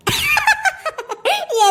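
high-pitched giggle; mocking high laugh. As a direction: burst into a squeaky laugh. Daughter-in-law laughs at aunt.

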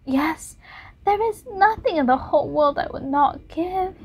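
put-on young Dorian pleading. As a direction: beg for eternal youth.

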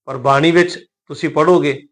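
ordinary delivery

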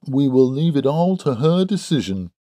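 high, smug put-on voice; smirking young Drebber. A narrator's reading. Vain suitor leaves it to the maiden, smirking. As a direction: concede smugly, voice lifted. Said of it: "smirking at his own reflection in the glass".

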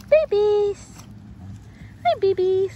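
high, drawn-out puppy call; cooing greeting. Real, not acted. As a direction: greet puppies in a high coo. Greeting puppies.

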